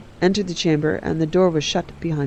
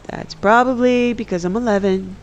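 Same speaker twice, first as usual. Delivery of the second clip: a hint of a higher boy's voice; lightly voiced child, pitched up; young son; 6 semitones higher than usual. A narrator's reading. Uriel answering his mother's teasing, boyish.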